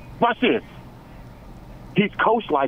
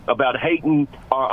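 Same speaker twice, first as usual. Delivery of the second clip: ordinary talk, nothing marked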